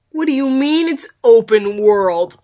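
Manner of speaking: ordinary talk, nothing marked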